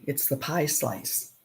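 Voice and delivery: normal talking voice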